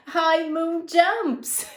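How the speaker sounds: reading through a laugh; giggly storyteller. Real, not acted. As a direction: laugh your way through the line. Laughing while reading jumps.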